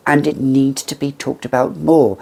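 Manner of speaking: ordinary talk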